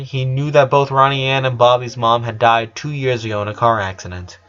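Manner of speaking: plain reading voice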